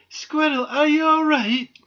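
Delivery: high, loud anxious question; worried. A narrator's reading. Doll runs to fallen squirrel, worried. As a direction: ask urgently if they are hurt. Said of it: "Marianne was worried".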